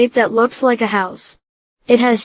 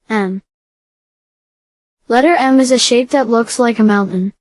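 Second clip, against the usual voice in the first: ordinary talk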